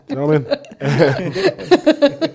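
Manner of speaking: high, loud, slow through laughter; can barely get the words out; laughing. Real, not acted. Host cracking up at the masturbation joke, laughing.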